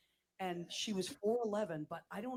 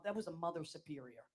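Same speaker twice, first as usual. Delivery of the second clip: ordinary talk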